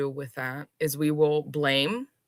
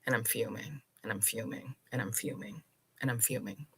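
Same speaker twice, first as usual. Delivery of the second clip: dropping lower and softer each time, brooding; fading repetition; 7 semitones lower, 12 dB quieter, faster than usual. Shown as a demonstration. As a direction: let the words sink into a low, fading mutter. Repeats the fuming, letting it fester.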